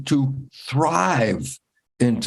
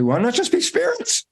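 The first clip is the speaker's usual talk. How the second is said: much higher, rising on be; high wondering question. Retold or imitated.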